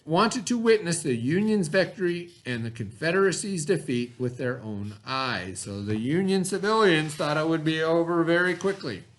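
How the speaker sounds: ordinary talk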